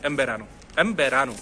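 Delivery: एम said as em, lips closed into बेरानो; m before b. Shown as a demonstration. Teacher shows the native way, em. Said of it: "मैं ले रहा हूँ मैं".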